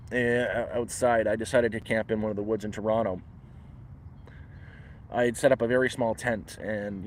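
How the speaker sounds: ordinary talk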